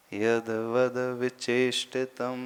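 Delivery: यदवद विचेष्टितम slow with a short वि, not a long वी. Shown as a demonstration. Teacher models correct recitation for students. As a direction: keep वि short and say it slowly. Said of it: "वी दीर्घ बोल लेते हैं".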